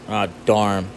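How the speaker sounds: ordinary talk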